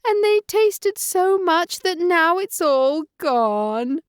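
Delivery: put-on baby bear crying over his porridge, squeaky; high-pitched baby bear cry. A narrator's reading. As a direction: wail it like a tiny upset cub. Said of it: "cried Baby Bear in his high-pitched voice".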